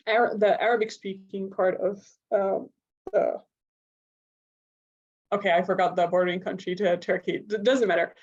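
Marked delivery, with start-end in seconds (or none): none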